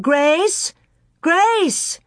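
high-pitched search call. A narrator's reading. Ma calling for her lost little girl, high call. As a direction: call out high for the missing child. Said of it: "and she called".

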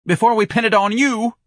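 high, loud threat; wild. A narrator's reading. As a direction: threaten him hysterically. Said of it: "Missus Levy answered wildly".

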